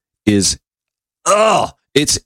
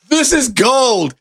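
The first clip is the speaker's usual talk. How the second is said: delighted relish; 12 semitones higher, much slower than usual. Real, not acted. Relishing the columnist's zinger.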